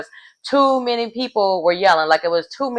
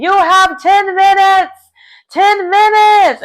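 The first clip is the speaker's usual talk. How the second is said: much higher, louder, slow imitating yell; retold scream; 12 semitones higher, 6 dB louder, slower than usual. Host Claudia yells the time left to contestants, imitated scream. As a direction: bellow the deadline at the players. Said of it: "Claudia is screaming".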